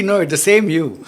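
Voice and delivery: ordinary talk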